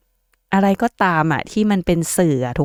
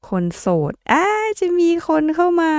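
high squealing delight, far above her usual voice; excited squeal; 13 semitones higher than usual. Real, not acted. Squealing to single viewers about new love coming. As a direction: shriek the good news to single viewers. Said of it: "กรี๊ดทำไม".

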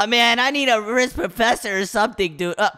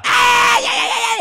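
very high, loud shriek; high-pitched yelling in mock agony; death scream; 14 semitones higher, 5 dB louder, slower than usual. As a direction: shriek as the game kills you. Killed by touching the yellow window.

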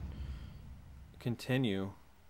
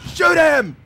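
panicked shout; 14 semitones higher, 10 dB louder than usual. Real, not acted.

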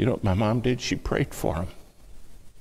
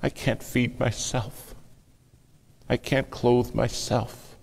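slow pleading; 5 semitones higher, slower than usual. Acted. Helpless prayer, slowed.

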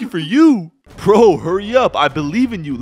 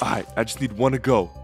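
ordinary delivery

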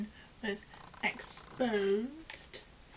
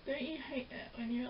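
normal talking voice

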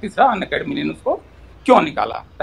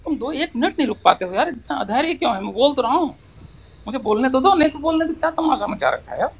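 high-pitched, aggrieved pleading; fed up, voice pitched well up; exasperated protest; 7 semitones higher than usual. Real, not acted. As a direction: plead to be allowed to talk. Pleads to be allowed to speak.

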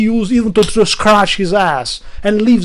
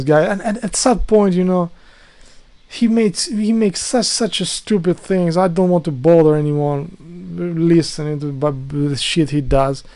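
ordinary talk

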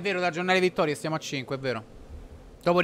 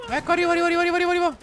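excited sing-song; 12 semitones higher, 3 dB louder, much faster than usual. Real, not acted. Hurrying to join teammate.

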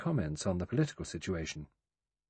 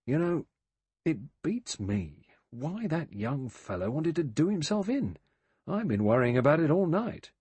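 ruminating; 6 semitones higher than usual. A narrator's reading. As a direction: turn it over in your mind aloud. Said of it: "in a ruminating voice".

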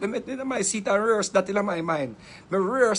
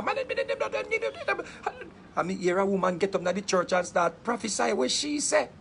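ordinary delivery

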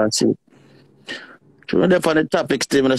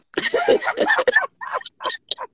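high giggle; 4 dB quieter than usual. Real, not acted. Friends joking, high laugh.

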